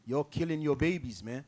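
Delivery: normal talking voice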